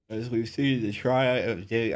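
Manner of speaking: words stretched through a yawn. Real, not acted. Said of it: "I'm yawning".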